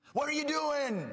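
high, loud exasperated yell; mock coach yell. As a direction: bark at the kids like a frustrated coach. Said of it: "isn't to yell at them".

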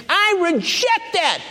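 high, drawn-out declaration; emphatic rejection. Acted. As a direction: stretch each word in a high, resolute proclamation. Models privilege of rejecting temptation.

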